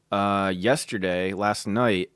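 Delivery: ordinary talk, nothing marked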